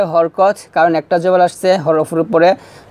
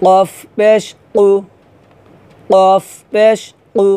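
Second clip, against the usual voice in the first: slow spelling drill; slower than usual. Shown as a demonstration. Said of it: "তাহলে আমার সাথে পড়েন".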